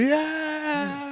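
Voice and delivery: high, long mock cheer; pirate crew cheer. Crew answering the captain, high drawn-out cheer. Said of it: "then they'd be like".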